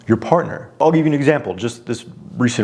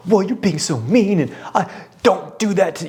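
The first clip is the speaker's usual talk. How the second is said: high, whiny mock protest; hammed-up emotional nagging, pitched well up; 8 semitones higher than usual. Husband acting his old emotional reaction, mock nagging. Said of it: "me getting nagging".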